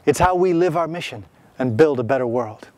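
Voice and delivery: normal talking voice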